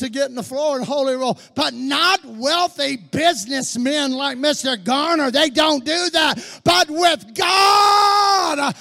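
loud, high shouted sermon build; fiery preaching. Acted. Preacher shouting to his congregation, fiery and loud. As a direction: preach it to the rafters, pounding each phrase.

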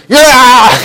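jolted high shout; mock a startled driver; startled yelp. Driver jolted by dad's holler, imitated yelp.

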